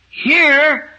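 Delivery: very slow, high, loud; drawn-out proclamation. Real, not acted. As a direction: stretch every word for emphasis. Preacher's emphatic announcement.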